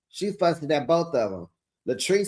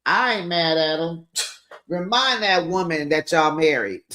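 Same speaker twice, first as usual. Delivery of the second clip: ordinary talk, nothing marked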